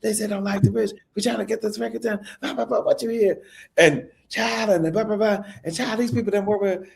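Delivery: hoarse Southern impression, pitched high. Singer venting to the songwriter about the bridge, high hoarse impression. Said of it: "like she was always hoarse".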